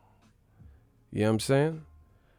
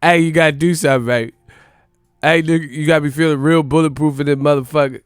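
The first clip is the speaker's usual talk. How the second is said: higher, louder, put-on [name] voice; [name] impression; 4 semitones higher, 9 dB louder, faster than usual. Shown as a demonstration. Showing how a real impression sounds, [name] voice. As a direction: sell it like [name] himself. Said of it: "sound like fifty".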